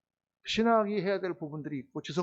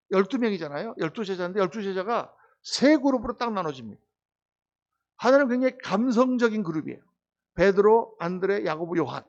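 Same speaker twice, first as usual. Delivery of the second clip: normal talking voice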